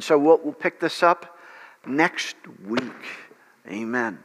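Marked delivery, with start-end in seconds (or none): none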